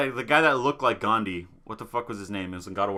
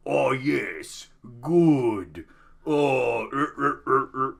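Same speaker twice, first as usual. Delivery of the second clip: Kratos voice; much slower than usual. Retold or imitated. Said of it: "Kratos is like".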